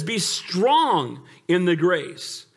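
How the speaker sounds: very slow, drawn out; deliberate slowed scripture; slow emphatic. Acted. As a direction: draw it out, pressing strong. Verse repeated slowly.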